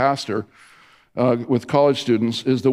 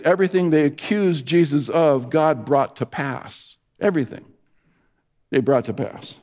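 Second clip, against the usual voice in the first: usual speaking voice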